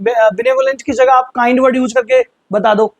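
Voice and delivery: usual speaking voice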